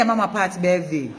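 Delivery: ordinary talk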